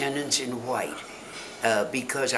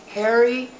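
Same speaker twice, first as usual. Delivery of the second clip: calling out; 11 semitones higher, 7 dB louder than usual. Real, not acted. Calling out to husband in audience.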